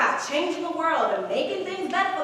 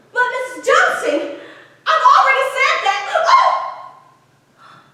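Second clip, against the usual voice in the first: a maid pleading with her mistress, higher and louder; rehearsing a maid; 5 semitones higher, 7 dB louder than usual. Acted. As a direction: climb into flustered protest as she's cornered. [name] rehearses maid role.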